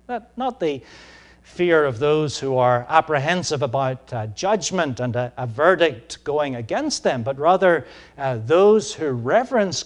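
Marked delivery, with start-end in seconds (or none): none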